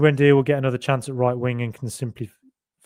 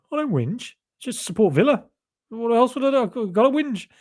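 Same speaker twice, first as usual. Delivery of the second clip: high-pitched, indignant whinge in self-defence; mock whingeing; 10 semitones higher than usual. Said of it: "You really whinge".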